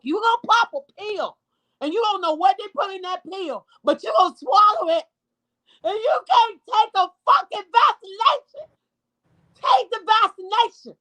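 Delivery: fired-up outburst at vaccine refusers; angry plea. Real, not acted. Mother shouting at viewers to get vaccinated, in real anger.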